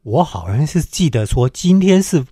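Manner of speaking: usual speaking voice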